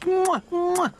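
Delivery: high, smacking mwah kisses; playful kiss noises; loud kissing sounds. Acted. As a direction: plant two noisy kisses. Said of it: "She gave Billy two kisses".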